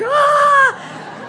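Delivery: sudden high, loud "ah!"; jump-scare scream. Retold or imitated. Scarer bursting out at haunted trail guests, screaming. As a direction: leap out screaming to spook them. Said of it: "he would fly out and be like".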